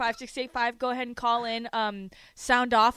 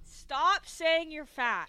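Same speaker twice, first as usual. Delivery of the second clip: higher, slow and insistent reproach; exasperated scolding; 5 semitones higher, much slower than usual. Real, not acted. Host tells co-host to quit mocking himself, exasperated scolding. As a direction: spell it out slowly, like a telling-off.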